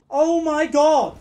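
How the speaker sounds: usual speaking voice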